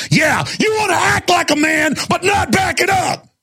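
high, shouting dare in a retold face-off; mock tough-guy challenge. Retelling the theater line fight, a high mocking shouted dare. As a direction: throw the challenge back at him, hammed up. Retelling facing down the big man.

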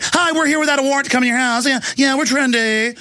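high-pitched mock trendy official. Trendy green police at your door, mocking Australian. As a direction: put on a high, sing-song trendy enforcer. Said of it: "in the trendy voice".

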